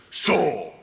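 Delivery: acted Russian policeman interrogating; Russian cop. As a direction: play an intimidating cop with a Russian accent. Acts Russian cop confronting him.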